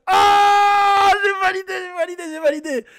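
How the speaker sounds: high, loud excited cry; excited approval. Real, not acted. Reactor validates the rapper's flow, thrilled shouting.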